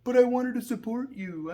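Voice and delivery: high pleading voice, mock hurt; whiny imitation. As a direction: pitch it up like a sulky friend who means well. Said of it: "And she's like".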